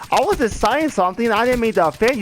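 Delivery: high, hurried mock protest. Man telling the woman he meant no offense, protesting.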